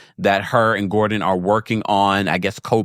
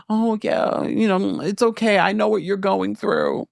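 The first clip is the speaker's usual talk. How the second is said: high-pitched, put-on sympathy; mock consoling; 10 semitones higher than usual. Jacqueline sitting by her side, mock consoling. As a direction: imitate a sidekick offering comfort in a much higher voice.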